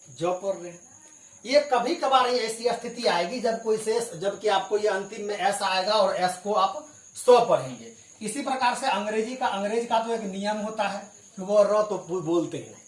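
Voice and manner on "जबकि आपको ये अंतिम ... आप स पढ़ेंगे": ordinary delivery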